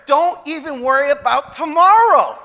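loud, high, building to a peak; impassioned preaching. Real, not acted. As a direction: cry it out to the whole room. Preacher's climax, confessing own worry.

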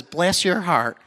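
imitated Southern mother-in-law; mock pity with a Southern accent; lightly mimicked Southern belle, pitying; Southern sympathy. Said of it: "She says".

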